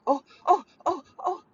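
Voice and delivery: high, staccato mock-panicked ohs; Michael's self-alarm. Stepdad talking himself into alarm, mock panic. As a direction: imitate someone working himself into alarm, in short bursts. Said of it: "he alarms himself".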